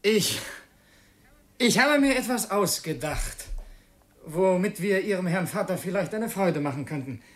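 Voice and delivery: flustered excitement; eager stammer. Acted. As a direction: blurt out the idea nervously. Eager, stammering proposal to Katja.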